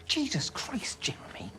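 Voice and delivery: hushed, appalled gasp of a curse; alarmed whisper at his friend. Real, not acted. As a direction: whisper it in horror.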